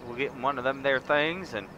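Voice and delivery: loud, hammed-up southern accent like a ranch hand; southern ranch talk. Gamer inviting viewers down south, loud mock southern accent. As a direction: lay on a big Southern accent, welcoming a partner. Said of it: "I could go all southern on you".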